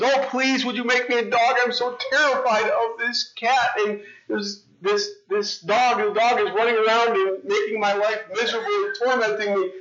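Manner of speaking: high, begging cat voice; pleading cat. Acted. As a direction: plead like a scared little cat. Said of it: "the cat says".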